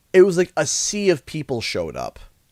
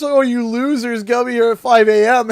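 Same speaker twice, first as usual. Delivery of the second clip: mocking jeer; 9 semitones higher, 6 dB louder than usual. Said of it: "some people started to make fun of us".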